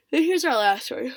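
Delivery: said through a big yawn. Real, not acted. Teaching circular script, words swallowed by a yawn. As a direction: stretch the words out mid-yawn. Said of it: "I'm very yawny today".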